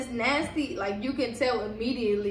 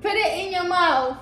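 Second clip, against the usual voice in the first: excited; 7 semitones higher, 9 dB louder than usual. Real, not acted. Egging on friend.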